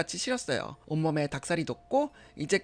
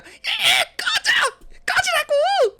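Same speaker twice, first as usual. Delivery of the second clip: very high, loud screamed outburst; screaming get lost; 8 dB louder, much slower than usual. Acted. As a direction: scream him away, furious. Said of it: "소리 질렀어요".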